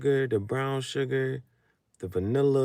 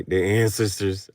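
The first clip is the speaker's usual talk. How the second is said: ordinary delivery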